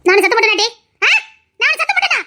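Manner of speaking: high, rising indignation; indignant denial. Acted. Indignant denial of shouting in quarrel.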